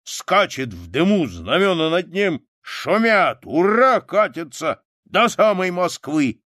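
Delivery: put-on high, loud, excited old soldier; soldier's rousing war tale; loud bragging. A narrator's reading. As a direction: brag with rising pride, voice well up. Soldier boasts loudly of his service.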